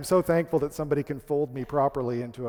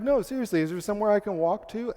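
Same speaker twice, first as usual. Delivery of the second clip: higher-pitched, insistent retold plea; earnest insistence; 5 semitones higher than usual. Hotel guest pressing amused clerks, earnest insistence.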